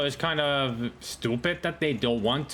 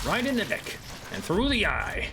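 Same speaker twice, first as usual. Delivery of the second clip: high, swooping call of a hit; kill-shot callout; 7 semitones higher than usual. Real, not acted. Player calls out a sniper hit, excited. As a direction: call the shot with rising-and-falling relish.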